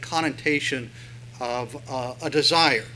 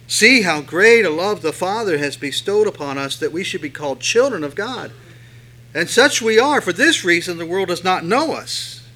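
loud, fervent reading of the verses; scripture reading; 5 dB louder than usual. Acted. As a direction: proclaim the verses to the congregation. Reads scripture aloud with force.

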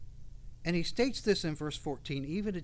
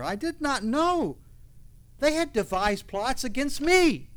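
fiery preaching; 7 semitones higher, 9 dB louder than usual. Acted. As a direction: preach it full force to the congregation. Preaching at full force.

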